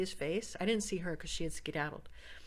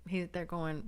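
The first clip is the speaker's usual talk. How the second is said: normal talking voice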